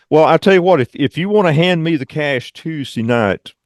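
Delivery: ordinary delivery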